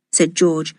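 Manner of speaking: ordinary delivery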